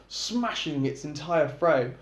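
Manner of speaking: usual speaking voice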